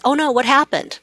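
high, caring oh no; concerned mom. Retold or imitated. Mom in version two, gently concerned. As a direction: show real worry for the teen. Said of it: "it's like".

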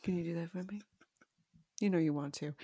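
soft, low, reserved sweet-talk. Acted. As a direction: coax softly like a serious, sultry star. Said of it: "quiet, reserved talk, very serious, very sexy".

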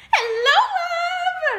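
high and loud sweet greeting; sing-song barista. Retold or imitated. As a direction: coo it at the customer. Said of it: "singing girl greeting".

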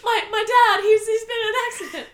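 high, loud mock-panic wail; hammed-up distress through laughter; mock frantic plea. Acting out frantic movie line.

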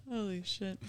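ordinary delivery

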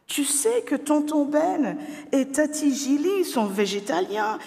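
put-on high-pitched child's voice, insistent; a little girl pleading with her dad; protesting girl. A narrator's reading. Daughter protests to her father, high and insistent. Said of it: "Mais Sophie proteste".

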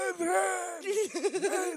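high, loud, drawn-out cry; hammed-up movie-hero shout for his love; Rocky impression. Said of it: "that's your go-to Rocky".

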